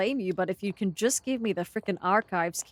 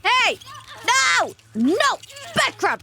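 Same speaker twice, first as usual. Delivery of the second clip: high, loud shouts at the crab; scolding shout; 13 semitones higher, 6 dB louder than usual. Real, not acted. Streamer yelling at a crab enemy in game, scolding. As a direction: shoo the pest off, yelling.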